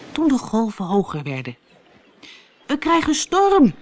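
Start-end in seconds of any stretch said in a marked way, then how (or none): 2.7-3.7 higher, louder call across the waves. Acted. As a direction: call out over the storm. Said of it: "riep U K naar de sleepboot".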